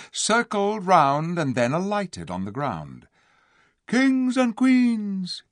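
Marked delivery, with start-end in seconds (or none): 3.9-5.4 much higher, louder, slow proud call; eagle's cry. A narrator's reading. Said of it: "he cried".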